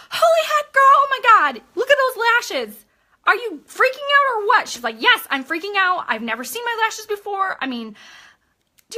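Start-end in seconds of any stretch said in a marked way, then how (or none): 0.1-4.7 high, loud, thrilled gushing over the lashes; excited squeal. Retold or imitated. Seller gushing to customer over her new lashes, excited squeal. 5.0-7.6 higher, louder, a touch of thrilled gushing; excited customer. Retold or imitated. Customer answering the seller about her lashes, mildly thrilled.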